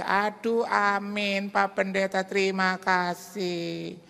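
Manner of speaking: put-on high, slow old lady voice; lightly voiced elderly widow; old woman caller. Old widow phoning the pastor, high and drawn out.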